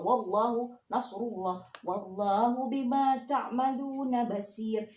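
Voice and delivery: measured Quran recitation, "Wallahu" said with heavy lam; thick lam in Allah. Shown as a demonstration. Teacher shows how Allah is read after fathah, thick and slow. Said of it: "ini harus dibaca tebal".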